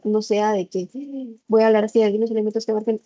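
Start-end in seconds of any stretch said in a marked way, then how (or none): 1.5-3.0 rattled off far too fast, words run together; rushed speech. Shown as a demonstration. Teacher showing students how rushed speech sounds, rattled off fast. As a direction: gabble it so nobody can follow. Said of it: "no se me va a entender".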